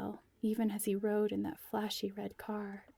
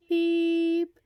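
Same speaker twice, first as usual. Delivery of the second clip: high, loud flat beep; imitated beep; 8 semitones higher, 10 dB louder than usual. A narrator's reading. Narrator imitates the phone beep, high and steady. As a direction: sound out the phone tone.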